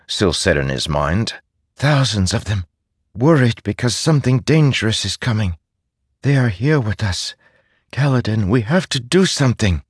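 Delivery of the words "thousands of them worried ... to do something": anxious, urgent small spirit; worried spirit voice. Acted. Syl's voice in audiobook.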